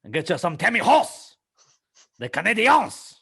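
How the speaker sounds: high, loud Québécois caricature; hammed-up French-Canadian shout; French Canadian accent. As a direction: draw out the French endings with gusto. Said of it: "French Canadian".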